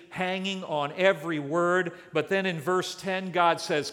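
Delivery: usual speaking voice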